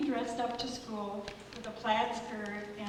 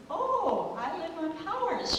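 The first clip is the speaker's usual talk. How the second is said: higher, louder surprised reply; lightly voiced girl delighted at the news; excited girl; 8 semitones higher, 4 dB louder than usual. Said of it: "And she says".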